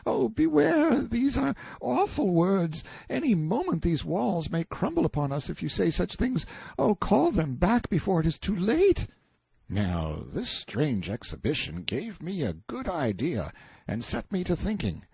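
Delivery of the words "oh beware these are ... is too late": put-on very high, terrified boy; frantic fright. A narrator's reading. Boy begs prisoner to unsay awful words, terrified. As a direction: plead in panic, pitched way up. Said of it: "out of his mind with fright".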